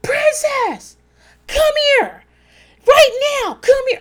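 high, loud holler across a room; mock-bellow for someone across a crowded party; imitated screaming. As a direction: imitate a husband yelling from afar. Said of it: "he screamed across the room".

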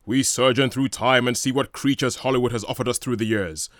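booming, slow 1950s trailer voiceover; overly dramatic voiceover guy, loud and drawn out; movie trailer announcer. Acted. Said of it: "my nineteen fifties movie trailer announcer guy".

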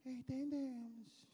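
small singsong schoolboy voice; meek little kids. Retold or imitated. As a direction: answer like obedient little boys, stretching the word. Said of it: "A gente, entendemos".

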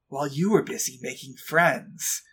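low, brooding edgy hedgehog; lightly voiced broody antihero; Shadow voice. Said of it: "your Kaiba voice is, is very similar to your Shadow voice".

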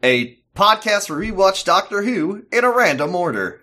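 upbeat announcer voice; podcast host intro. Acted.

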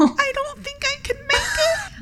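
very high, feeble melodrama; mock dying. Mouse pretends to be fatally hurt, mock dying. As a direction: play the dying victim for laughs. Faking dying after crash.

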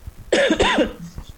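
sudden coughing fit; choking cough. Real, not acted. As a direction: cough hard, water down the wrong way. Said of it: "I'm choking on my water right now".

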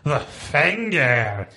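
"The finger." dragged out slowly, leaning hard on "finger"; slow, pointed "the finger"; stressed the finger. Shown as a demonstration. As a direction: give "The finger." slow, heavy italic weight.

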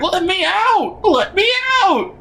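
high, desperate muffled pleas for help; trapped voice pleading to be let out; distressed cry. Retold or imitated. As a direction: beg to be freed, trapped and panicking. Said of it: "they sound very much in distress".

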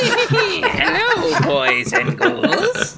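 laughing through a mock creepy greeting. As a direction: giggle through the words.

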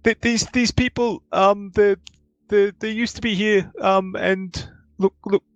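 high, hesitant stammering voice; flustered high voice caught out; nervous Gordon. Acted.

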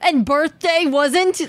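high, slow teasing question; teasing challenge. Acted. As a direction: challenge him playfully.

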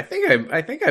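duck voice. Shown as a demonstration. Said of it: "Can you say nominee".

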